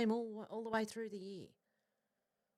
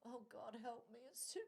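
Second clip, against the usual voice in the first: faint, weary plea as if melting in the heat; feeble melting moan; 4 semitones higher, 18 dB quieter than usual. Pretend. Joking that the hot day will melt her, faint pleading. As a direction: barely get the words out, wilting. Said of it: "I'll be laying there going".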